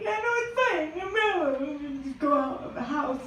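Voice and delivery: high, airy brush-off; a high, falsely fine reply; high mock-breezy voice. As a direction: chirp it out falsely cheerful. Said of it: "You're like".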